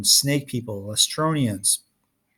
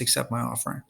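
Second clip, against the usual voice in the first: much softer, a quiet plea; hushed prayer; 7 dB quieter, faster than usual. A narrator's reading. Percy praying silently to the goddess, hushed. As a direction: pray it under your breath.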